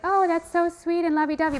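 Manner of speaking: high, gushy mockery; lightly mocking sentimentality; mock gushing. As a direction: ham up the sweet reaction lightly. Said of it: "it sounds totally like".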